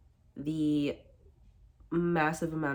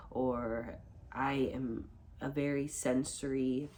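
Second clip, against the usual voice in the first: ordinary talk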